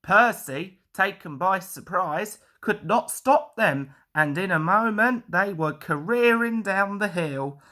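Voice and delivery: ordinary delivery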